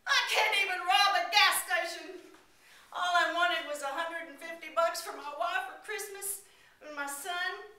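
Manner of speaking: high, loud broken confession; a touch of sobbing; desperate robber breaking down; mild tearful despair. Acted. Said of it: "fell to the ground in a pile of tears".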